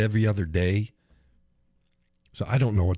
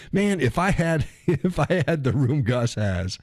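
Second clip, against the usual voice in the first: laughing; 6 semitones higher, slower than usual. Retold or imitated. Laughing while retelling what he told his wife.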